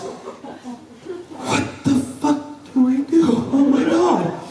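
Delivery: very high-pitched slow panicked squeak; hammed-up helpless horror, stretched out high; mock panic. Mom's date facing the exploded diaper, high mock panic.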